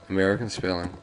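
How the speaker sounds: ordinary delivery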